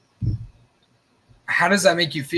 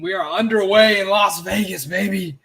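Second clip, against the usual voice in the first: higher, louder hype call; hyped announcer; 6 semitones higher, 5 dB louder than usual. Real, not acted. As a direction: announce it like a broadcaster. Announcing kickoff.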